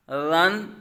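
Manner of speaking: رن begun with a gentle "th" as in "this"; soft Dhal sound. Shown as a demonstration. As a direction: soften the opening of رن, no whistle. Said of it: "نرمی سے اس کو ادا کیا گیا".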